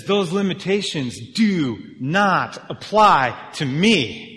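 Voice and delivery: grand, deliberate claim; emphatic declaration. Retold or imitated. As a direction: hammer out each word slowly. Voicing Jesus' bold claim.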